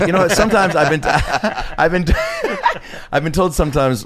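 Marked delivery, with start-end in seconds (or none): 1.0-3.1 laughing. Real, not acted. Laughing mid-story.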